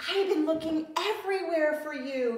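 小狮子高兴地快速说找了你好久; purring. Acted. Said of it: "he purred".

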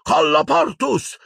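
very high, slow incantation cry; shouted spell. A narrator's reading. Harry seals doors against attackers, shouting.

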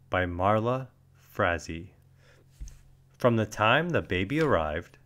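usual speaking voice

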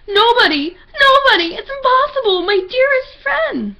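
very high, loud melodramatic wail; overacted anime dub. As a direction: ham up the grief, wailing.

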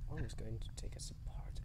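hushed breathy whisper; real nervous whisper. Real, not acted. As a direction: whisper so nothing hears you. Whispering during scary scene.

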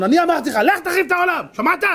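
furious at being disobeyed; angry rebuke. Acted. Rabbi rebukes returning messenger.

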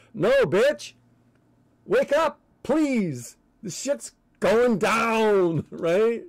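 high ranting yell; shouting. Real, not acted. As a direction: yell it to wake them up. Ranting at listeners to wake up.

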